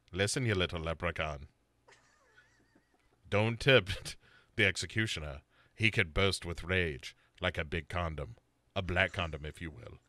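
low, quiet menacing killer. Retold or imitated. Bo as the killer threatening Sarah, low and quiet. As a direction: growl it low and soft like a threatening villain. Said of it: "he's like".

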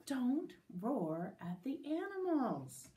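slow, spaced-out telling-off; stern teacher. Acted. Teacher to all the kids, slow deliberate scolding. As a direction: scold word by word.

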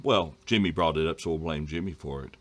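ordinary delivery

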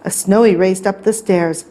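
ordinary delivery, nothing marked

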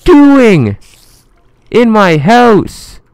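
high, piercing shout at an intruder; drawn-out high-pitched holler; outraged yell. Real, not acted. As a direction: yell it high and loud, stretching the last word. Yells at game creeper.

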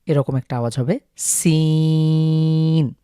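সিন drawn out slowly and clearly; Arabic sin. Shown as a demonstration. Teacher showing viewers the সিন sound, slow. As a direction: lengthen সিন at the end. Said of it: "এস যেভাবে উচ্চারণ করি".

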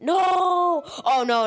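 loud, high, drawn-out cry; big high yell of refusal; dismayed shout. Real, not acted. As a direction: wail it in horror at who turned up. Unwanted character appears.

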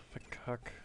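leise vor sich hin murmeln; leise gemurmelt. Shown as a demonstration. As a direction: frustriert leise nachgemurmelt. (leise gemurmelt)

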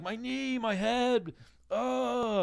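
very high whimpering; high-pitched mocking moan; mock pained wail.

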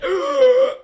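loud high gagging groan; disgusted gag. Retold or imitated. Retelling inhaling the zombie's spit, loud gag.

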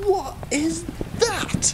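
high, slow, startled cry; startled question. Acted. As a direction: pitch it up and stretch it out in alarm. Crew spots strange creature aboard.

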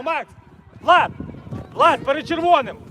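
ordinary talk

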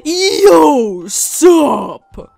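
very loud, booming greeting; mock shouting. Player typing with caps lock on, mock shouting. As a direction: bellow it like an all-caps message. Reading own all-caps chat message.

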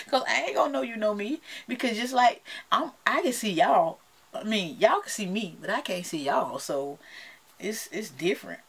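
usual speaking voice

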